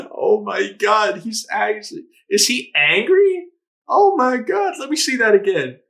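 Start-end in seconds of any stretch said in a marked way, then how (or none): none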